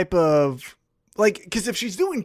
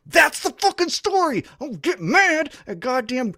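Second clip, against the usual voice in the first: high-pitched, heated outburst; furious rant; 8 semitones higher than usual. Real, not acted. Fan berating wrestlers who blame fans, furious rant. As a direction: let real outrage push the pitch right up. Ranting at wrestlers about boss's writing.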